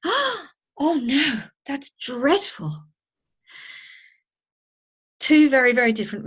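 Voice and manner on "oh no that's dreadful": a high, drawn-out oh no, then slow dismay; shocked sympathy. Retold or imitated. Hearing of a colleague's accident, shocked and sorry. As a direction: let the bad news land, slowly. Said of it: "your reaction is".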